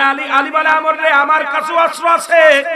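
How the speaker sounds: high, loud boasting cry; shouted challenge on the battlefield; boastful shout. Acted. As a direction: roar the boast at the enemy. Challenger boasting of his weapons.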